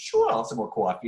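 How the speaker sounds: put-on shoor and kwawfee in a light New York accent. Retelling his reply to a New Yorker, in a light New York accent. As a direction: answer like a born New Yorker. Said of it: "my New York dialect was good".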